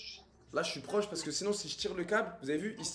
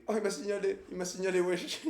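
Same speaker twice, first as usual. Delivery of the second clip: normal talking voice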